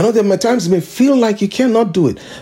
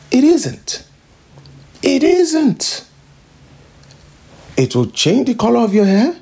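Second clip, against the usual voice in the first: higher, driving preacher's rise; fired-up preaching; 5 semitones higher than usual. Real, not acted. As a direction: build the fervour line by line. Preaching rises in intensity.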